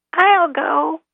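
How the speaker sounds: high, tiny voice; small, high-pitched volunteer. Acted. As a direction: pipe up as a tiny creature. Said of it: "said a small voice".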